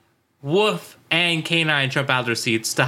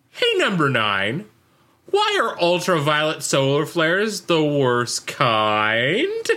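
slow riddle setup, swooping up at the end; imitated drawn-out question with a big rising finish; leading joke-setup question; slower than usual. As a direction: stretch it out like a pun is coming. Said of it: "And he says it like that".

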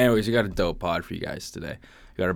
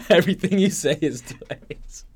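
laughing tease; 7 semitones higher, 7 dB louder than usual. Real, not acted. Teasing cohost, laughing.

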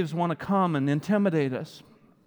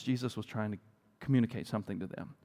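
ordinary delivery

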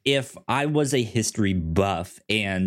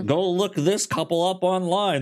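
mock film prompt; 9 semitones higher than usual.

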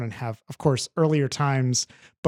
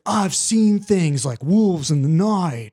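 slow, lightly put-on British accent, not quite landing; a hint of a wooden British accent, drawn out; Keanu Reeves's bad British; 3 semitones higher, 3 dB louder, slower than usual. Mocking an actor's failed British accent to a friend, slow. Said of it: "and he's like".

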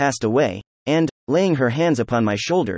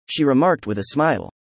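usual speaking voice

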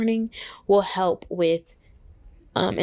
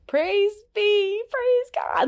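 much higher, breathless praise trailing to a whisper; high airy hallelujah; joyful praise; 13 semitones higher, much slower than usual. Retold or imitated. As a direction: gush thanks to God in delight. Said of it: "now I'm like".

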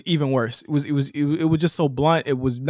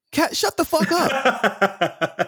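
high, very slow "Kat, shut the fuck up"; mock exasperated put-down; drawn-out dismissive snap; 11 semitones higher, much slower than usual. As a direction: stretch it out with contempt. Quoting Maddie, mocking.

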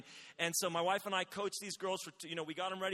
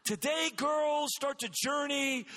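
louder, higher, slow, each word punched; rousing coach pep talk; 7 semitones higher, 6 dB louder, slower than usual. Retold or imitated. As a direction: hammer the line home to the team. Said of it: "my speech was based around".

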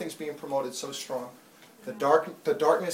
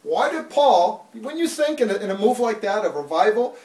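full-force preaching; 5 semitones higher, 8 dB louder than usual. Acted.

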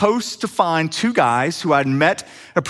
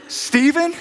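higher, rising surprised "Stephen?"; surprised recognition; 10 semitones higher than usual. Retold or imitated. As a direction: echo the surprise with a lifted question. Joel surprised in return.

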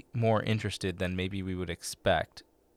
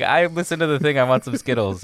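put-on higher, louder eager kid demanding candy; mock kid asking for Skittles; 6 semitones higher, 7 dB louder than usual. A kid at youth group claiming the promised Skittles, eager and a bit louder. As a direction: play a kid claiming his Skittles prize. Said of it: "show up to youth group and be like".

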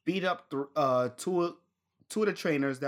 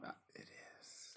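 under the breath, almost no voice; very soft, hesitant whisper; 26 dB quieter than usual. Real, not acted. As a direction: concede it in a tiny whisper. Conceding a point under his breath.

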